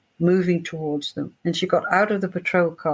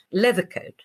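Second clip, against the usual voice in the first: ordinary talk, nothing marked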